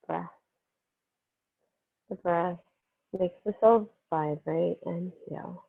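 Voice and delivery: calm, unhurried meditation voice with long pauses; guided meditation. Real, not acted. Yoga teacher easing students into breath and healing energy, guided meditation. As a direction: settle them gently into the breath, phrase by phrase.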